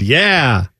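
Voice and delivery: ordinary talk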